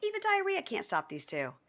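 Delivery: high-pitched parody ad slogan; mock commercial tagline. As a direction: deliver it as a cheesy commercial tagline. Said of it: "That sounds like a commercial for diarrhea".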